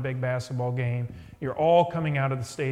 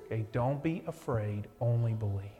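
soft repeated reassurance; 6 semitones lower, 6 dB quieter than usual. Shown as a demonstration. Preacher softly repeating Jesus' words to the congregation. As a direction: lower and softer, said gently. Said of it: "these are the words I'm gonna be giving you".

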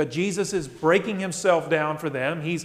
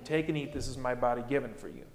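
low, soft words of Jesus, a little quicker; hushed liturgy; 6 semitones lower, 9 dB quieter, faster than usual. Acted. Pastor softly voicing Jesus offering the bread, hushed. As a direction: murmur the invitation gently. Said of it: "His body broken for you".

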